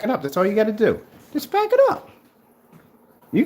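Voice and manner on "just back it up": taunting challenge. Real, not acted. Taunting a rival to prove claims.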